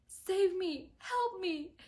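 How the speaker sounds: high desperate plea, voicing Mare; pleading for help. Mare begging for rescue, high mock plea. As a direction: beg to be saved, mock panicked. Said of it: "Mare's like".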